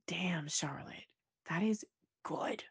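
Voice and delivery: hushed, impressed aside; breathy admiring whisper under the breath. Retold or imitated. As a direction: whisper it, slow and awed. Quoting her own impressed reaction.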